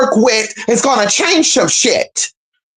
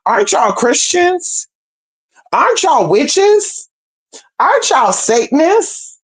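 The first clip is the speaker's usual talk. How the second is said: mocking question; 7 semitones higher, slower than usual. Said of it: "I'm like".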